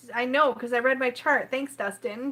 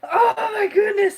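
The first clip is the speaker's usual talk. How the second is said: high, slow tearful cry; overwhelmed sob of words; teary exclaim; 8 semitones higher, 8 dB louder, slower than usual. Real, not acted. Overcome with tears on stream, slow teary exclaim.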